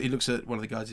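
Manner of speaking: normal talking voice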